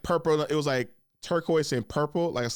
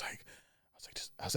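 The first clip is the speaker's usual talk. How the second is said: whisper; 17 dB quieter than usual. Real, not acted. Whispering aside in story.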